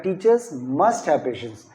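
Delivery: "must" given firm weight, slowly; slow model sentence, leaning hard on "must"; stress on must. Shown as a demonstration. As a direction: demonstrate the emphasis on "must". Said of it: "must पे stress देंगे".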